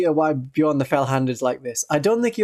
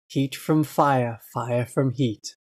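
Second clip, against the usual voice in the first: mellowed voice; 3 semitones lower, slower than usual. Shown as a demonstration. Streamer warming up her voice, slow and mellow. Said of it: "the thing you've got to say to mellow out your voice".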